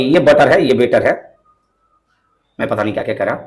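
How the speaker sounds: normal talking voice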